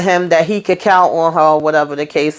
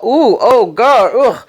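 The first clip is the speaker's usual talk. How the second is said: mock disgusted groan; 4 semitones higher, slower than usual. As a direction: play it like he can't stand the smell. Zach reacts to being served.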